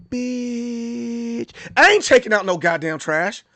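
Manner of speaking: drawn-out first word, then cocky defiance; put-on swaggering defiance; bragging kid impression. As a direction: play a kid boasting of backtalk. Voices kid bragging to his brother.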